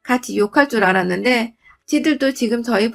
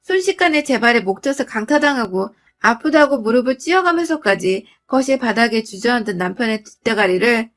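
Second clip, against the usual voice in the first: usual speaking voice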